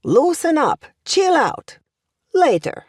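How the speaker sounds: put-on a teacher hamming up young American talk; imitating American youth. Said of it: "That's how the young in America talk".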